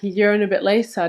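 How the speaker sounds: ordinary delivery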